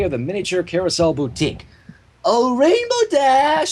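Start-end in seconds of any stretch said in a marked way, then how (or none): 2.2-3.7 high, slow, trilled Rarity imitation; a pony trilling her friend's name. A narrator's reading. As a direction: sing the name out elegantly. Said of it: "she trilled".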